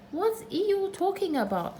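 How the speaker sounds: high little voice; Piglet voice. A narrator's reading. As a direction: ask like a small nervous piglet. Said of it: "whispered to Pooh".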